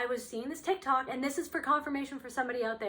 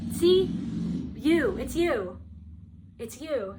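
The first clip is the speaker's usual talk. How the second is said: mock accusing; 5 dB louder, slower than usual. Abuser turns around to blame the victim, mock accusing. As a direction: imitate someone pinning the blame.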